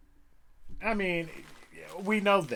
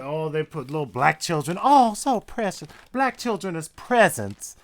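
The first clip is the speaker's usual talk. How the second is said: mock gushing; 7 dB louder than usual. Mocking the newspaper photo, sarcastic gushing. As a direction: gush over the photo with mock delight.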